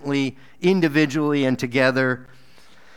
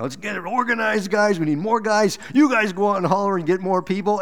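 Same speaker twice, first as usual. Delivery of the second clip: lightly voiced team organizer, higher pitch; rally the guys in a mock pep talk, higher; eager organizer; 6 semitones higher than usual. Acted quote of eager organizer.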